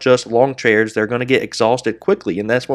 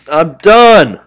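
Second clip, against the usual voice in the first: loud, higher, final "done" slammed down; fed-up outburst; 5 semitones higher, 10 dB louder than usual. Real, not acted. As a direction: snap it out, throwing in the towel. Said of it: "Very frustrated with that".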